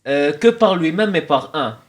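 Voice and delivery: put-on immigrant accent in French; thick immigrant accent in French; blédard accent. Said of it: "pourquoi j'ai pris un accent blédard".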